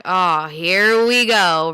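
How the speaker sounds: slow, dragged-out "here we go", exasperated; sarcastic, slowed-down sigh of "here we go"; sarcastic eye roll. Retold or imitated. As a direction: draw out "Oh, here we go" like you're rolling your eyes. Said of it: "Pull the classic eye roll".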